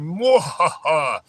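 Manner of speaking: slow, put-on villain mwahaha; hammed-up evil cackle; mock evil laugh. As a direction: imitate a killer gloating with a cackle. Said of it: "smiling and going".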